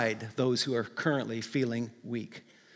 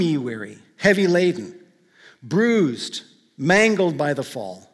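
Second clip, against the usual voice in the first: hymn recitation. Acted. Pastor reading an old hymn to the congregation, solemn recitation.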